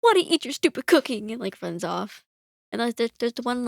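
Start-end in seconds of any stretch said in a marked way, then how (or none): 0.0-1.3 put-on flustered boy. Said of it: "And he's like".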